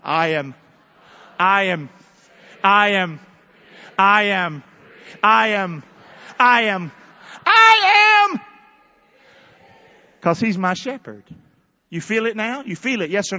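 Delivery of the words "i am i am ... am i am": loud chant rising to a shout; out loud, building. Shown as a demonstration. Preacher leading the congregation's declaration, louder each time. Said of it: "Say 'em out loud".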